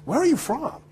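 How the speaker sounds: high-pitched imitation of a curious woman; retold curious question. Breakfast server questions the actor, curious and high. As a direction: ask it high, imitating her.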